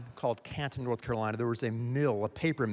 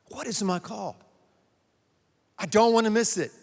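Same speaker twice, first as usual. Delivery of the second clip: high, loud plea to God; preacher pleading at raised pitch; impassioned preaching; 7 semitones higher, 6 dB louder than usual. Acted. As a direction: cry out urgently to God. Impassioned prayer acted in sermon.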